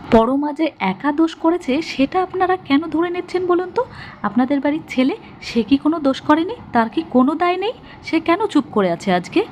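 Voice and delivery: high, rising accusing questions; indignant challenge. A narrator's reading. Sudipa defends Parama to the family, indignantly challenging. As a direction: push each question up in protest.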